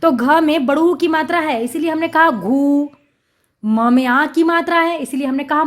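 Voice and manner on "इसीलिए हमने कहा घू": normal talking voice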